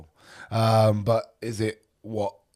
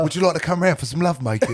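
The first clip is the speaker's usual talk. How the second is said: put-on laughing Hugh Grant-style British accent, higher and louder; mock posh English seduction line; 7 semitones higher, 7 dB louder than usual. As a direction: ham up a smooth British charmer. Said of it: "the British accent as well".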